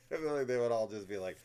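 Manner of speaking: breaking into loud laughter mid-sentence; laughing. Real, not acted. Picturing dudes in a dick trap, laughing out loud.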